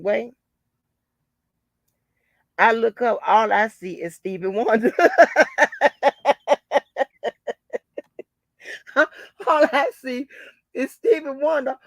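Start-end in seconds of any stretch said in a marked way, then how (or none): 5.0-8.2 big helpless cackle; loud laugh. Real, not acted. Laughing at the [name] image, loud cackle. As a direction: burst out laughing hard. Laughing at her own story.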